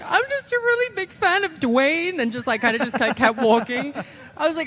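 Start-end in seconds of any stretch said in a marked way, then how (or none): 0.0-2.2 awkward cover story. Retold or imitated. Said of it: "And I'm just like".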